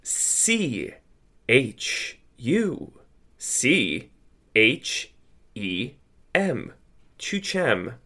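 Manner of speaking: letter by letter with pauses; "C H U C H E M" spelled slowly, then "Chu Chem"; spelling it out. Real, not acted. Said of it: "[name], please spell Chu Chem".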